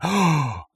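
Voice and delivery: sharp imitated gasp; shocked gasp. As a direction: gasp like a stunned audience. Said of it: "multiple people going".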